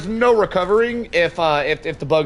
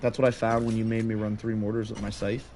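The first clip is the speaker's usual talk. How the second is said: mumbling quietly; 8 semitones lower, 13 dB quieter than usual. Real, not acted. Mumbling to himself very softly.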